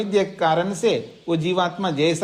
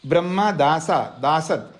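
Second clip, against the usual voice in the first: usual speaking voice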